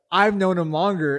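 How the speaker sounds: high, loud self-justifying comeback; defensive mom. Retold or imitated. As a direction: imitate a mom insisting she's right. Mom defending herself in argument.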